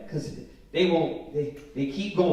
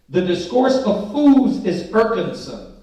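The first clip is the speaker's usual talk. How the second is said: scripture reading; 5 dB louder, slower than usual. Acted. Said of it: "It says".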